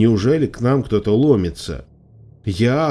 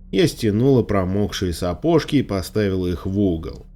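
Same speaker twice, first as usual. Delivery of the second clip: ordinary delivery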